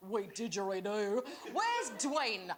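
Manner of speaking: hammed-up fake Australian accent; terrible mock-Australian accent. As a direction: put on a bad Australian twang. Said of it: "my offensive accent".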